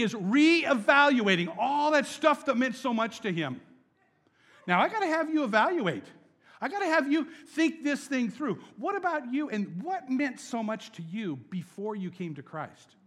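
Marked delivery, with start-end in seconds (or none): 4.7-10.9 excited urging. Real, not acted. Preaching with a laugh.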